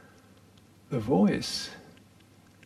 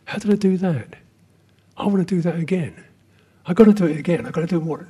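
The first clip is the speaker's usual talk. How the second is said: higher and louder, greedy and excited to repeat it; eager craving voice; 5 semitones higher, 7 dB louder, faster than usual. Acted. Teacher voicing the meditator's craving mind, eager and excited. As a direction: play the grasping mind hungry for more. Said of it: "Did you hear that voice?".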